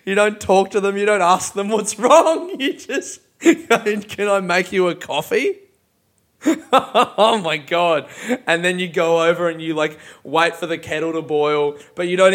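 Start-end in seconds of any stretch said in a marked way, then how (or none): 3.4-5.6 mock awkward offer. Mocks Susan's awkward offer. 6.4-8.1 real laughter through the words; laughing. Real, not acted. Laughing at imagined scene.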